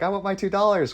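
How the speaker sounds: high-pitched, insistent quote; movie kid's demand. Retold or imitated. Quoting Better Off Dead to a friend, high insistent demand. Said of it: "Better Off Dead when he's like".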